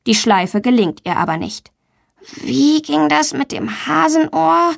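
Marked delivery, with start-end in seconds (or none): 2.4-4.7 slow, higher-pitched wondering; child thinking aloud, drawn out; pondering. A narrator's reading. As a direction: puzzle it out slowly to yourself. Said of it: "überlegt sie".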